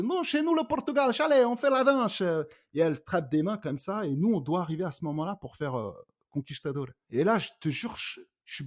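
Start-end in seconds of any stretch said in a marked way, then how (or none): 0.0-2.2 much higher, hammed-up fake Portuguese accent; mock the comedian's cliché Portuguese accent, high and loud; caricature Portuguese accent. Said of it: "qui dit".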